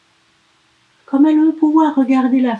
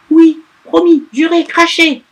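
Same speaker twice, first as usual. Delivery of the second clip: high, loud quick promise; eager elf; 8 semitones higher, 8 dB louder than usual. Acted. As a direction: swear it eagerly like a child.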